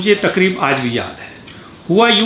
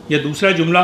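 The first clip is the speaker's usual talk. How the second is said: ordinary delivery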